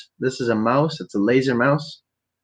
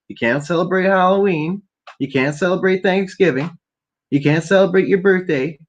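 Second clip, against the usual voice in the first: mock authority; 8 semitones higher than usual. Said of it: "mocking rules".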